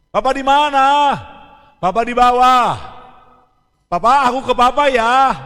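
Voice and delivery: put-on loud child voice calling between floors. Son asks where papa is, loud calling. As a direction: call across the house to find papa.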